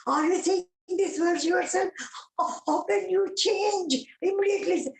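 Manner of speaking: high-pitched incredulous questioning; astonished disbelief. Retold or imitated. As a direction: ask in amazed disbelief, voice lifted high. Acted astonished reply to Swami.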